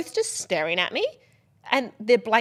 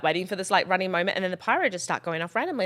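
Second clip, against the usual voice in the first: ordinary talk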